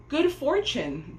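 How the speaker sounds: exclaiming. A narrator's reading. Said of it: "the old man exclaimed".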